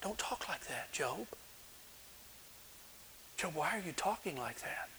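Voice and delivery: urgent, very soft shushing; hushing someone. Acted. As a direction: hush them as if others might overhear. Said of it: "the first thing we generally do when we hear people talk like this".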